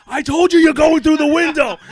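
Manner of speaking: high, loud mock yell at the heckler; mock furious yell. Angry Ranger fan threatening the heckler, loud mock yell. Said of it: "he literally goes".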